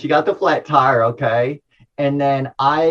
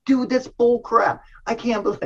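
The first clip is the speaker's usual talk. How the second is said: high, slowed-down mock frustration; frustrated retort; 9 semitones higher than usual. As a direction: retell an irritated dad brushing off a warning. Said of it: "I was just real frustrated".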